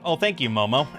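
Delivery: ordinary delivery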